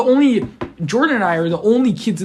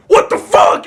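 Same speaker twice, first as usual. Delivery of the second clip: much higher and louder rough shout; imitated shocked shout; mock startled yell; 14 semitones higher, 7 dB louder, slower than usual. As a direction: yell on spotting the kid. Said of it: "he's like".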